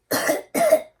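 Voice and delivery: high, loud, breathy hacking cough; dry dragon cough. Acted. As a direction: cough out an empty puff of smoke. Dragon's fire runs out.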